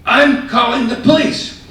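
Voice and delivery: very high, loud enraged shout; furious shout. Retold or imitated. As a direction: scream it like you're about to call the cops. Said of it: "And he was just furious".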